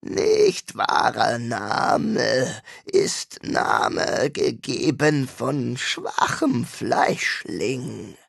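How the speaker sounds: slow, scratchy, alien creature voice; scratchy demon voice. A narrator's reading. The demon scoffs at the name Owen gave it, in a slow scratchy voice. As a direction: grind out each word like a throat unused to speech. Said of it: "Die Stimme klang kratzig".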